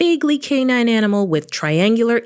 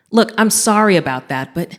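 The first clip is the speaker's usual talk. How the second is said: plain reading voice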